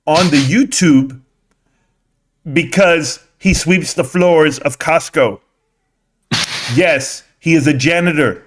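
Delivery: mock the slow speaker's cadence; slow mocking retelling. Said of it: "he said it a lot slower than that".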